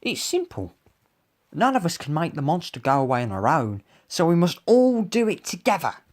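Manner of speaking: high small-character voice, quickening; piping, animated little creature. A narrator's reading. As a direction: explain eagerly in a little high voice. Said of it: "said Basil".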